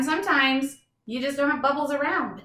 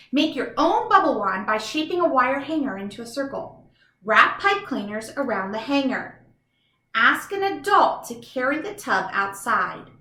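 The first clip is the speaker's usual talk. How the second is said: louder, lively storybook steps; reading to children; 5 dB louder than usual. Acted. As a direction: read the steps out brightly to kids.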